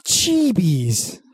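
put-on high, slow fussy old neighbor; grumpy old neighbor. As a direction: complain about the noise.

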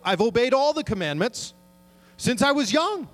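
mock-eager rich man asking Jesus. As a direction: play him smug and chirpy. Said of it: "this person comes up to Jesus".

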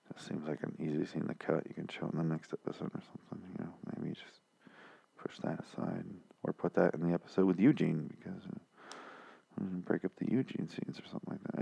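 trailing off into a whisper; fading to a whisper. Real, not acted. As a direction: let it sink to a near whisper. Voice fades into near whisper.

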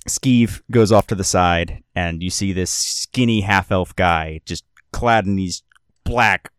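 ordinary talk, nothing marked

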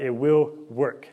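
very slow, stressing WILL; emphatic slow assurance. Real, not acted. Preacher reassuring listeners, stress on will, slow. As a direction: land "will" heavily, drawn out. Preacher affirming God's promise.

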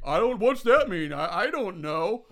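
loud, put-on dim-witted puzzlement; mock clueless guy. Host mocks Damien not knowing the phrase, clueless voice. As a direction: imitate someone totally baffled, slowing at the end.

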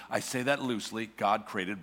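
ordinary talk

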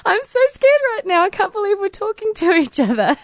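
very high-pitched mock squeal; starstruck panic. Swimmer re-enacting her inner panic beside her idol, starstruck squeal. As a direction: squeal it like an overawed fan. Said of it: "quite starstruck and intimidated".

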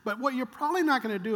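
normal talking voice

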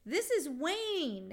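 annoying, high, drawn-out squeal; irritating high drawl; Victoria Jackson. Acted. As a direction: stretch it out high and slow, to annoy. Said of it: "in total Victoria Jackson annoying voice".

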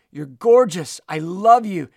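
punchy, loud declaration of love; preacher voicing God's delight; exuberant affirmation. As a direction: proclaim each compliment with force.

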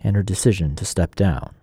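polished broadcast read over the music; radio feature read. Acted. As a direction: read it like a public radio arts segment intro. Host reads segment intro.

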